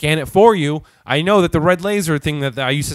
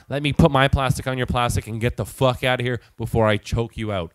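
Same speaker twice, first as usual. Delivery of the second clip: ordinary talk, nothing marked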